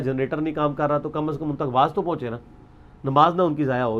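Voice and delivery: ordinary talk, nothing marked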